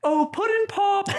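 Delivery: high, sing-out catchphrase; Josh letting rip. Retold or imitated. Friend taunting the bet loser from across the room, high mock call. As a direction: toss it out high to taunt someone. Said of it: "he just lets ... rip".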